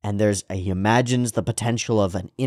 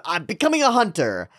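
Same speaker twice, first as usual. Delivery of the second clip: shonen hero; 10 semitones higher than usual. Pretend. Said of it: "shonen protagonist speech".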